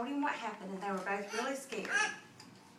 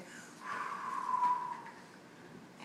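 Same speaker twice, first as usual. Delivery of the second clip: long whooshing blow of air; long high blow. Acted. Wolf blowing at the brick house, long whoosh. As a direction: blow as hard as you can. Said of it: "and he said".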